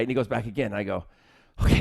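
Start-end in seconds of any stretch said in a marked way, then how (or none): none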